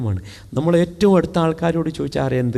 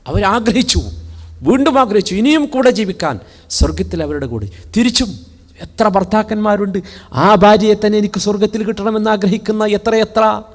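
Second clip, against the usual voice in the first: preaching at full force; 8 semitones higher, 3 dB louder than usual. Acted. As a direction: proclaim the verse to the hall. (ഉച്ചത്തിൽ പ്രസംഗം)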